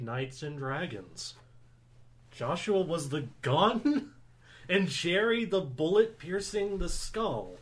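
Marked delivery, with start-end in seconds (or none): 2.3-7.6 laughing. Real, not acted. Laughing while reading grim line.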